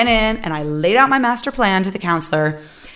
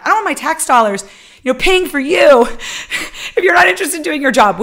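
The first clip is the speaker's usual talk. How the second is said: higher, louder and fast, in her face; furious outburst; 7 semitones higher, 4 dB louder, faster than usual. Retold or imitated. Student yelling at the counselor about tax dollars, fast and loud. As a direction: let the rage spill out quickly. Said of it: "getting in her face and being like".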